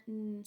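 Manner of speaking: N as a held "nnn", not the letter name; letter-sound demo. Shown as a demonstration. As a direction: hum the bare sound of N. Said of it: "making the N sound".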